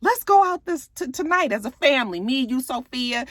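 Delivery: high, coaxing mother; coaxing mom voice. Retold or imitated. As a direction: soothe him in a lifted pleading voice. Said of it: "And she was like".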